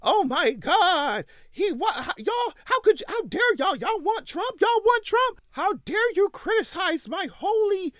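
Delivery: high, whiny mock outrage; mocking outraged supporters. Mocking Democrats upset about criticism of Biden, high and whiny. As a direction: squeal it like a scandalized fan clutching pearls. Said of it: "y'all were just".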